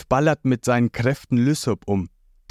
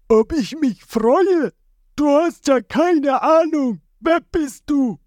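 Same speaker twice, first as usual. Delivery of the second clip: high, louder, slow bitter giant; Boogie's reply; 3 dB louder, slower than usual. A narrator's reading. The giant turns on the stranger, high bitter voice. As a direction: push back, weary and bitter. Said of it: "Boogie bleibt stehen".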